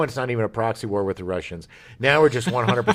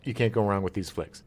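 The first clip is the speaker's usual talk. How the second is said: normal talking voice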